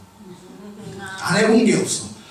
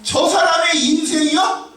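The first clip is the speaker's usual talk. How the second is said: raised, pressing sermon voice with shouted peaks; fervent preaching; 9 semitones higher than usual. Acted. Pastor exhorting the congregation at full force. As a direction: drive the point home to the pews.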